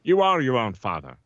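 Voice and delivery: lightly put-on actor's voice; Patrick Stewart. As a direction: deliver it as a mild celebrity impression. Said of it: "it could be Patrick Stewart without the accent".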